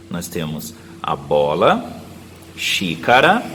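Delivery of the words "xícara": ordinary talk, nothing marked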